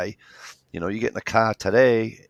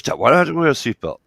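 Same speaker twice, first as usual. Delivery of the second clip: put-on higher, louder and fast, like a complaining teen; whiny daughter; 7 semitones higher, 8 dB louder, faster than usual. Father imitating his daughter arguing about her seatbelt, whiny. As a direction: pitch it up into a fast teenage whine.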